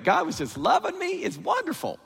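high-pitched, gushing new-believer rapture; gushing delight. Acted. Preacher voices a believer in the honeymoon period, gushing.

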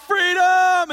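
huge high yell of the word, full force; battle shout. Acted. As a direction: roar it to the whole army. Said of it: "And then he would shout".

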